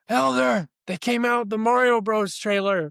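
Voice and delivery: high, loud call across the room; retold excited yell. Missionary calling across to his companion about a trailer, excited yell. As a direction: re-enact yelling out big news. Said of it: "I just yelled across".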